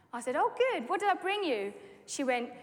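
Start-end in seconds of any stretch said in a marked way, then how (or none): 0.4-1.7 eager reply. Retold or imitated.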